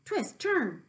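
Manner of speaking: high, loud goose voice urging; a goose yelling at the pig to dodge; goose crying out. Acted. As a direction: honk it out like an excited goose. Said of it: "cried the goose".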